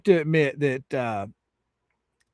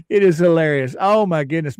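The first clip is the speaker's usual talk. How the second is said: normal talking voice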